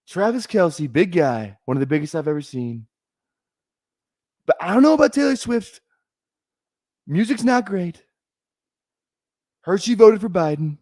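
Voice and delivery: high-pitched camp president parody; comic presidential impression, pitched well up; Trump impersonation. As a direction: pass judgement in a high, put-on politician voice.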